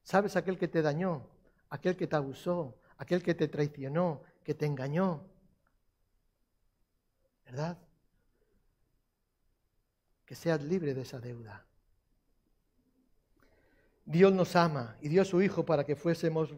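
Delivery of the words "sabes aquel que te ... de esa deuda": intimate sermon hush. Acted. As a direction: lean in gently to the wounded. (susurro pastoral)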